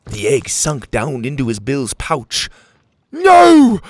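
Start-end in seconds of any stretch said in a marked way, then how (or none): 3.1-3.8 very loud, high-pitched cry; anguished shout. A narrator's reading. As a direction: scream in protest. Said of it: "Petey shouted".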